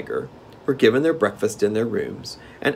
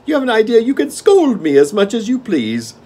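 gnome king; 13 semitones higher, 9 dB louder than usual. Acted. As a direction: relish the game like a spoiled monarch.

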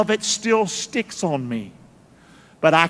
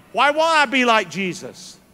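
climbing higher and louder into a shouted peak; preaching at full force; 4 semitones higher, 4 dB louder than usual. Acted. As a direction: whip the crowd up, voice soaring. Sermon climax builds.

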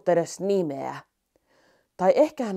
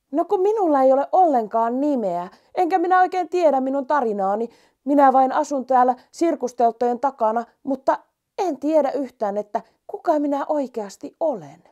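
higher, earnest little mouse; 7 semitones higher, 4 dB louder than usual. Acted. Mouse explaining to the lion it has no name, high and earnest. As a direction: plead as the small nameless mouse.

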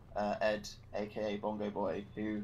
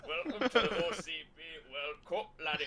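a mock Scottish accent, pitched up; hammed-up Glaswegian announcer; 4 semitones higher than usual. Shown as a demonstration. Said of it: "Glaswegian kind of accent".

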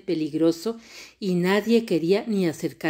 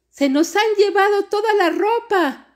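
very high, loud alarmed cry; shouting; 6 dB louder than usual. A narrator's reading. Smallest girl finding the clothes stolen, shouting. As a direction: shout it like a panicking little girl. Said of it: "dijo gritando".

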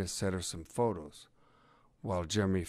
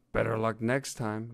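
plain reading voice

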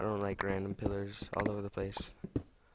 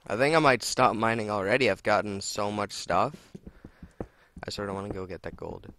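normal talking voice

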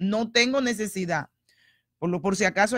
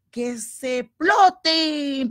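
slow, drawn-out, higher and louder; slow defiant challenge; 6 semitones higher, 5 dB louder, much slower than usual. Real, not acted. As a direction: spit each word out slowly in defiance. Defiant about the enemy.

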